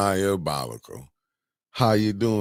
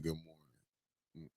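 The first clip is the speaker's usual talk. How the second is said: soft quoted greeting; 3 semitones lower, 20 dB quieter, much slower than usual. Retold or imitated. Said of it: "Oh, she said".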